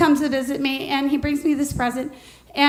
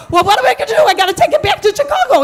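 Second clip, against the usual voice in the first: much higher, louder frantic wail; 8 semitones higher, 6 dB louder, faster than usual. Retold or imitated. Widow asks Denny what to do, high frantic wail. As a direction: squeal it out in a panic.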